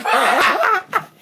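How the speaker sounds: brief laughing fit; high giggle. Real, not acted. Host laughs mid outro.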